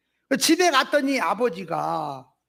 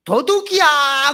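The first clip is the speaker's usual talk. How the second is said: loud, slow cry of thief!; alarm shout; 6 semitones higher, 7 dB louder, slower than usual. Retold or imitated. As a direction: yell it out to raise the alarm. Father fakes alarm, shouting thief.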